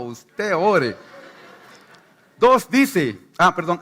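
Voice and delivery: usual speaking voice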